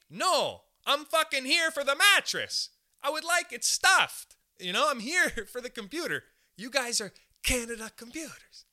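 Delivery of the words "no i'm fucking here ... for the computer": loud, high, furious rant; angry rant. Real, not acted. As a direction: blow up in indignation. Ranting at computer store clerk.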